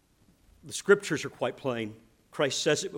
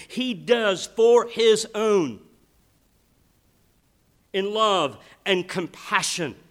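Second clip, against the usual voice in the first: fervent preaching; 6 semitones higher, 6 dB louder than usual. Acted. Pastor to his congregation on Christ's love, fervent preaching. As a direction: preach it out with rising fervour to the congregation. Preacher building to full force.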